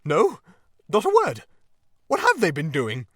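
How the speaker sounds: high, loud, agitated; alarmed Toad. A narrator's reading. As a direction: answer in rising alarm. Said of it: "trembling in every limb".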